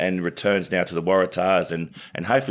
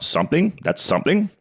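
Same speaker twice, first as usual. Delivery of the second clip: a mock admiring bloke's call, pitched much higher; imitating Carl Rackerman; 11 semitones higher than usual. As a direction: let "something" climb high with a rising tune, imitating him. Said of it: "this went on for all night".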